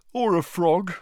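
words through a yawn. A narrator's reading. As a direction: say it mid-yawn. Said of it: "yawned Harry".

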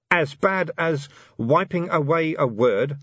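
sour, slow retort. A narrator's reading. Vimes answering the Grag sourly, slow and bitter. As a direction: bite the comparison off sourly. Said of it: "said Vimes sourly".

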